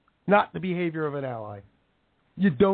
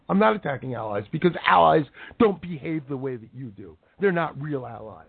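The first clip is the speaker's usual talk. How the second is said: ordinary talk, nothing marked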